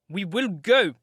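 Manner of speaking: a loud, defiant insistence; determined. Acted. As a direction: force it out while struggling. Catherine struggles for the key.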